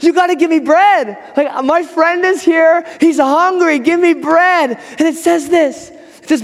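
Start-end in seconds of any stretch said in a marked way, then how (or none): 0.0-4.8 higher-pitched, drawn-out acted demand; insistent pleading. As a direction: play the shameless caller who won't give up. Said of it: "he keeps on knocking".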